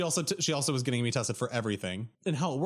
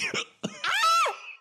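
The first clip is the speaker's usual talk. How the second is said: loud laugh; 5 dB louder than usual. Real, not acted.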